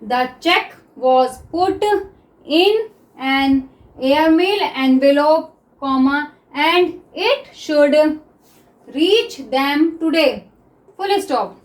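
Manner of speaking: sentence read very slowly with pauses; teacher's slow read-aloud; slow reading demo. Shown as a demonstration. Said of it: "अब देखिए next".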